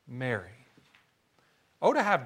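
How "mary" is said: tender hush. Retold or imitated. Jesus says her name.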